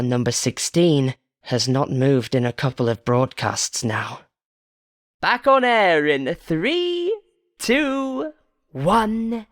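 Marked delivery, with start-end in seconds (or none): 5.2-9.5 high, slow countdown; countdown announcer. Acted. Host counting down to broadcast, high and slow. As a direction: count them back on air.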